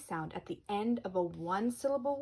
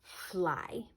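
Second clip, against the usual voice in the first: ordinary delivery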